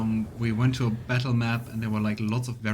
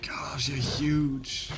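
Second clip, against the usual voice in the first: slow breathy whisper of amazement; awed whisper; 3 semitones higher, much slower than usual. Real, not acted. Gamer whispering in awe at a huge enemy. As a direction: hush it out slowly, in awe.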